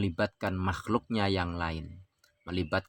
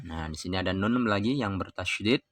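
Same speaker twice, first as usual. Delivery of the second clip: ordinary talk, nothing marked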